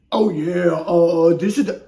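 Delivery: lightly voiced booming mascot; loud, hammed-up mascot voice; Kool-Aid Man. Botching a player's quote for viewers, hint of a mascot voice. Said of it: "Kool-Aid Man voice".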